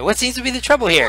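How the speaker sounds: put-on high, quick old man's voice; little old man. Old healer arriving to ask what's wrong, high old man voice. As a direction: pitch it up as a wrinkly old healer. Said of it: "old wrinkly guy".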